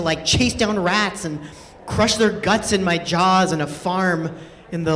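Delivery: ordinary talk, nothing marked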